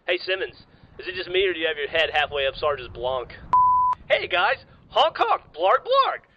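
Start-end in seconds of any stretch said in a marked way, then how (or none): 4.1-6.3 slow alien honking, climbing higher. Acted. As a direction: squeal the blargs higher and higher. Alien greets the guys.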